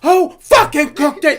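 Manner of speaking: high, loud, drawn-out demand; hammed-up furious shout; mock chef yelling. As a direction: bellow the question in exasperation. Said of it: "you're yelling at him".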